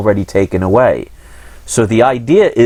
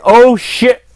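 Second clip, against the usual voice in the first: higher, louder cry of sudden realization; alarmed outburst; 10 semitones higher, 5 dB louder than usual. Acted. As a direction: blurt it as a mock last-second alarm. Imagined panic as train hits.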